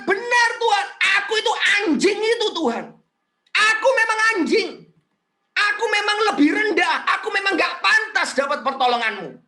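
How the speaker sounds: high-pitched, impassioned admission of unworthiness; self-abasing plea. Acted. Canaanite woman admitting her lowliness to Jesus, pleading. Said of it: "Seolah-olah perempuan itu mengatakan".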